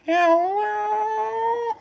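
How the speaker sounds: very high squeaky cat voice; cat saying hello. Retold or imitated. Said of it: "she sounds like she's saying".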